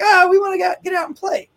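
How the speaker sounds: a high, excited dog's voice; eager dog voice. Retold or imitated. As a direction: voice the barking dog, high and keen. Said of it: "they're like".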